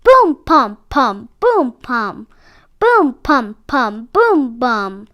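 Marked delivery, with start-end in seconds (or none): none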